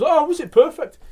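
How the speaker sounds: high, loud delighted exclamation; delighted reaction. Retold or imitated. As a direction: act out a stranger thrilled at the news. Stranger reacts keenly to birthday news.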